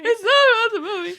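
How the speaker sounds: high whine breaking into laughter; mock tearful protest. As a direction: protest like a weepy mom while laughing.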